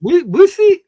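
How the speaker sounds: high, loud questioning "whiskey?" in a mock Indian accent; mock Indian-accented "Whi-, whiskey?". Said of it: "I don't know how they say whiskey".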